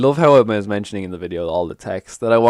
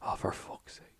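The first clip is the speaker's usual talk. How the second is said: breathy frustrated curse under the breath; 1 muttered curse; 21 dB quieter than usual. Real, not acted. Gamer losing a big play, breathy curse. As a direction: exhale the curse almost voiceless. Card game loss, swearing.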